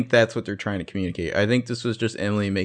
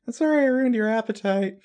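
high, sing-song fake sorry; sweetly creepy, pitched up high; mock apology; 12 semitones higher than usual. Mock line to the bartender.

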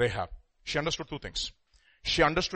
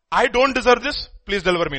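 higher and louder, protesting; indignant complaint; 6 semitones higher, 7 dB louder than usual. Shown as a demonstration. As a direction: demand it as if wronged. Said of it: "There's a lif-, difference".

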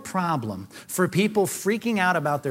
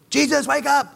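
urgent plea; 8 semitones higher, 4 dB louder than usual. Pretend. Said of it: "they ran down and said".

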